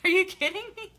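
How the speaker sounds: high loud mock shock; high surprised yelp; shocked disbelief. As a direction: shriek in disbelief. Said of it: "I'm like".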